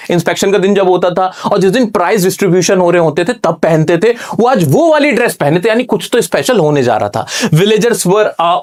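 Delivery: ordinary talk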